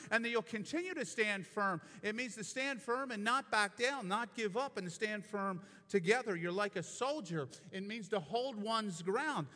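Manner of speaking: building sermon fervor, strong and driving; impassioned preaching. Acted. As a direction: preach it to the congregation with rising conviction.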